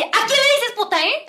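high, loud challenge; indignant retort. Retold or imitated. Girl confronting the señora over the insult, indignant retort. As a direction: re-enact snapping after holding back. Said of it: "Yo me estaba aguantando hasta que le dije".

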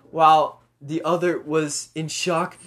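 ordinary delivery, nothing marked

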